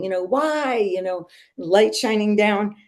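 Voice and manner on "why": high drawn-out retold plea; mock crying. Mother re-enacting her cry to God, high mock wail. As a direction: wail the one word, imitating crying. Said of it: "like, crying".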